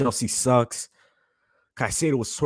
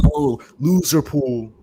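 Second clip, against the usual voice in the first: mocking rival fan; 6 dB louder, slower than usual. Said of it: "seeing their fan talking about some".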